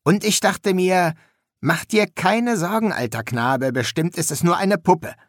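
chummy, carefree tone; breezy student Shelter. A narrator's reading. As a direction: recount your self-reassurance lightly.

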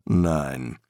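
low, considered master's voice; thoughtful, low reply; considered answer. A narrator's reading. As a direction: drop it low after a moment's thought. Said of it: "nach kurzem Nachdenken".

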